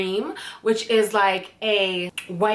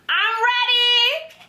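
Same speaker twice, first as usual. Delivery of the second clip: high, slow, squealed with glee; excited squeal; 14 semitones higher, 3 dB louder, slower than usual. Real, not acted. Vlogger thrilled to open her new body oil, squealing. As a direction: squeal it like you can't wait.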